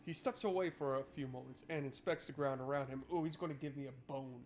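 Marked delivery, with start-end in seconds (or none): none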